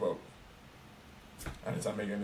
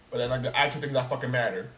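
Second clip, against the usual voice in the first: ordinary talk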